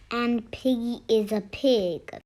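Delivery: ordinary delivery, nothing marked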